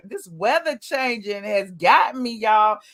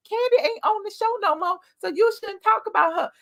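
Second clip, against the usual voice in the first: much higher, whiny mimic of viewers; mocking critics; 9 semitones higher than usual. Host mimicking viewers who complain about Kandi, higher and whiny. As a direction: parody the nagging commenters. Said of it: "people who be like".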